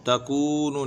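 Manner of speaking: तकूनु with short ta, long koo, short nu; only middle vowel long. Shown as a demonstration. Teacher showing which letter stretches, तकूनु with long कू. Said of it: "न ता को खींचना है".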